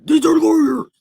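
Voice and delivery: high, crying shout in another voice; loud, high, warped wail; split-personality outburst. Acted. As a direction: scream it like an unhinged alter ego. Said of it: "This seriously giving me split personalities".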